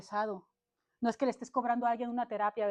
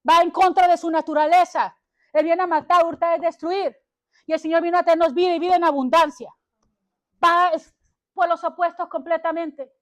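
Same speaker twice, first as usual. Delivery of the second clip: plain; 5 semitones higher, 9 dB louder than usual. Acted. Preaching at full force.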